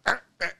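two high, loud startled yelps; high-pitched mock-shaken "ah, ah"; shaken cartoon yelps. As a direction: imitate a rattled cartoon character's jolted cries. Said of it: "he gets shaken. He goes".

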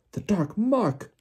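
high, drawn-out alarmed cry; Bagman panting. A narrator's reading. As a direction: cry it out in agitation. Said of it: "he panted".

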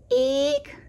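high mother bird eek; startled eek. A narrator's reading. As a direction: squeal at the sight of the babies. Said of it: "she cried".

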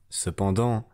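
ordinary delivery, nothing marked

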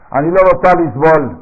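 put-on an old midwife ordering the patient; stern old midwife. Anciana partera regaña a la parturienta, voz alta y firme. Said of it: "le dijo la señora".

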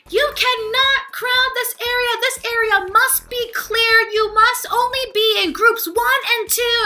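high, insistent announcement voice; yelling gate agent. Retold or imitated. Flight crew person to crowding passengers, yelling orders. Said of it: "This bitch is fucking yelling".

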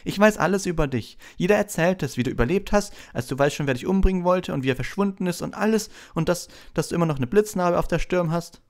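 put-on high, gushing young fan; excited high-pitched boy; eager Colin. A narrator's reading. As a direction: tumble through it with starstruck eagerness. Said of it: "sagte Colin Creevey begierig".

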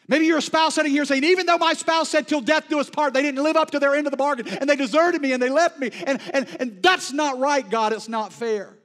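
loud, high, impassioned preaching that slows to drag out each shaken line; preaching at full force. Acted. Preacher voicing the prophet's fear to the congregation, full-force preaching. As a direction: preach it like the storm is already here.

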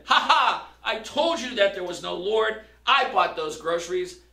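loud mocking triumph; gloating shout. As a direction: crow over her, gotcha. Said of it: "jumped out from behind the bushes and shouted".

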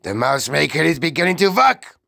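rapid, loud, rising shriek; shrieking witch. A narrator's reading. Witch seeing her mouse maker work, shrieking. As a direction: rush it upward in triumph. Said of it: "shrieked the Grand High Witch".

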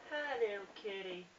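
high cooing voice for a kitty; baby talk to cat. Acted. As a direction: coo at the cat like a pet owner. Greeting a cat in game.

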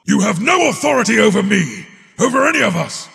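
fast, high, loud angry outburst; defiant shout. Acted. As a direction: hurl it back in fury. Defying an order in rage.

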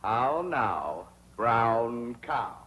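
How now, brown cow? with round drawn-out vowels; overdone elocution, How now, brown cow? stretched and precise; elocution drill. Shown as a demonstration. Suitor drills diction with Ellie, overdone elocution. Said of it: "practice diction and intonation".

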